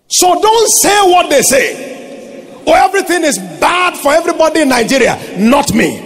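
high, forceful pulpit delivery; full-force preaching. Real, not acted. Pastor teaching that thinking rules speech, full-force preaching. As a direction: drive it home to the congregation.

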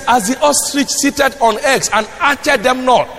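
high, ringing pulpit warning; forceful preaching. Real, not acted. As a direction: hammer the warning into the congregation.

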